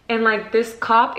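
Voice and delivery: ordinary delivery, nothing marked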